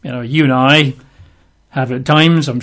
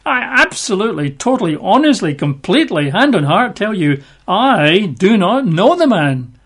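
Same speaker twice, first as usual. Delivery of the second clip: higher-pitched, hammed-up protestation; emphatic sworn denial; 5 semitones higher than usual. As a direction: swear it word by word, acting out innocence. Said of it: "He denied with an oath".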